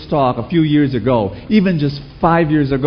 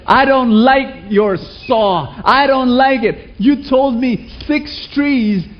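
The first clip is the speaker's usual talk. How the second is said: higher, slow, indignant complaint; angry complaint; 9 semitones higher, slower than usual. Acted. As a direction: complain like a cheated customer, higher and drawn out. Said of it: "very angry".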